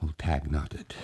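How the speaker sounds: usual speaking voice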